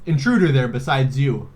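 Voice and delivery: normal talking voice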